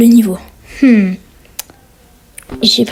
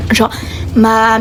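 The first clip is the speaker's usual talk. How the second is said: ordinary talk, nothing marked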